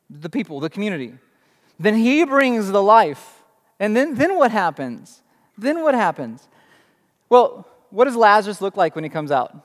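ordinary talk, nothing marked

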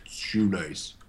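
low, soft gangster voice; Shoe Nice gone gangster. Retold or imitated. Caller naming himself on a callback, low gangster voice. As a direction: introduce yourself low and cool. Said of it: "Did he just go gangster on me".

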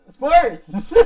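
loud laughing exclamation; excited shout. Real, not acted. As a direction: burst out with it, laughing. Excited outburst about AM sports.

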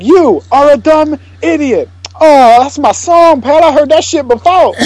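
lightly voiced blind man ready to fight; mock Pryor. Acting Pryor and Wilder scene.

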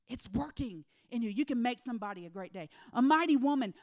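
loud, rising preacher cadence; the preacher rousing the congregation, loud; preaching at full force. Real, not acted. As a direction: build it up and hammer it home.

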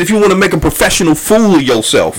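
Lupe talking spicy. Retold or imitated. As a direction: imitate someone spoiling for a fight. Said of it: "talking real spicy".

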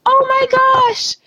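high, gushing, thrilled cashier; excited gushing. Retold or imitated. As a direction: squeal it out like a delighted cashier. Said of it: "And they're like".

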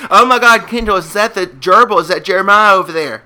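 excited alarm. Acted. Host thinks he spots the lost gerbil, excited. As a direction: blurt it out like a sudden sighting.

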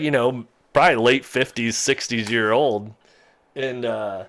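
ordinary delivery

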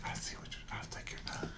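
attempted unvoiced whisper. Shown as a demonstration. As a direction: try a breathy whisper. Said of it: "That's why he can't whisper".

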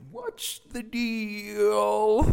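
slow, high falsetto with a long last word; silly high segment-name voice; mock title announcement. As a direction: draw it out high, milking the catchphrase. Repeating the segment title as a bit.

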